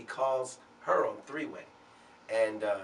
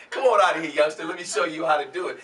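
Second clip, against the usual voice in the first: loud, raised, ribbing retold order; 7 semitones higher, 12 dB louder, faster than usual. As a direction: playfully boss the youngster aside. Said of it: "and he's like".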